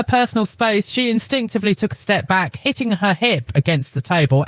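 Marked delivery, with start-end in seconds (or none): none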